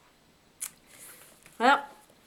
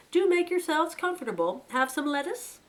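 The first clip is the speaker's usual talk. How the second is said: higher, louder hospitable host voice; genial chief rabbit; 10 semitones higher, 4 dB louder than usual. Acted. Chief rabbit offering lettuce to his guests, genial. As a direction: offer lettuce like a gracious old lord.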